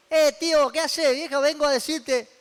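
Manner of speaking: loud, high, chummy hail; cheeky shout-out to an old pal; mock overfamiliar greeting. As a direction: barge in casually on royalty. Mock barging in on the king.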